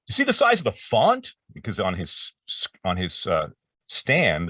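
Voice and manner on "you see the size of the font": amazed. Retold or imitated.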